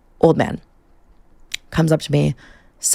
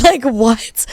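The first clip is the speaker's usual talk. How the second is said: laughing through it, pitch jumping higher; laughing disbelief; 7 semitones higher than usual. Real, not acted. Disbelief at the married men.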